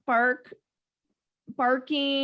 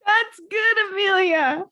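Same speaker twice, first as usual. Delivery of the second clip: delighted praise; 5 semitones higher, 5 dB louder, slower than usual. Real, not acted. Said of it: "laughing praise of the quote".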